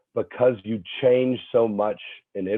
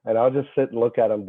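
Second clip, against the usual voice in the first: ordinary delivery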